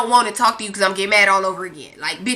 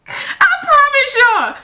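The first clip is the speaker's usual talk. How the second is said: laughing promise; 13 semitones higher, 3 dB louder, slower than usual. Real, not acted. Still laughing at herself.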